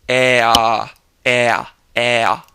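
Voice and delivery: a tight throaty "eh" opening then closing; open throated eh. Shown as a demonstration. Teacher showing learners the throaty German E sound. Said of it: "open your throat up from the E position".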